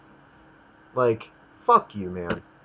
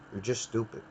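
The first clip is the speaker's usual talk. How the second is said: muttered much softer under the breath; quiet repeat; 12 dB quieter than usual. Real, not acted. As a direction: repeat it to yourself, barely audible. Ranting about the IT support guy.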